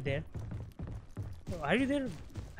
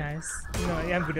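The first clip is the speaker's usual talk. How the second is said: normal talking voice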